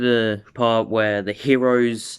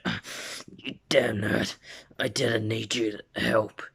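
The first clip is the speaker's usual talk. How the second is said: whispery, worn-out grudging mutter; Bakugo, spent grumble; 5 dB quieter than usual. Acted. Rescued fighter grumbles at the nerd before passing out, spent whisper. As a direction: act barely conscious and still annoyed.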